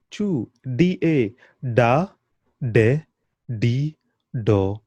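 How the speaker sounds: cha che chi cho chu, then da de di do, each apart; crisp model syllables; syllable drill. Shown as a demonstration. Teacher drilling syllables for learners to repeat. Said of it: "C H".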